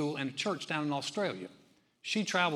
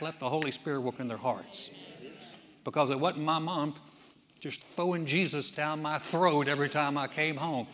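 normal talking voice

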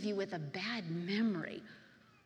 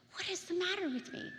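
higher-pitched, distressed question; retold tearful plea; 7 semitones higher than usual. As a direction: plead in re-enacted despair. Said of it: "I just fall into a bucket of tears".

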